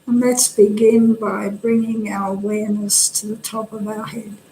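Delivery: calm, unhurried instruction with pauses between phrases; guided meditation. Real, not acted. Meditation teacher helping listeners settle the body, calm guidance. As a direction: settle the listeners gently into the practice.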